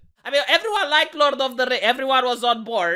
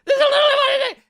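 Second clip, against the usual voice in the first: high, laughing disbelief; laughing shout; 5 dB louder, much faster than usual. Real, not acted. YouTuber laughing at the absurd news.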